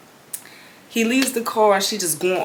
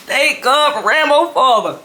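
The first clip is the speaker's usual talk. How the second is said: higher, louder laughing thanks; relieved laugh; 5 semitones higher, 4 dB louder than usual. Retold or imitated. Daughter relieved Rambo's father covered for her.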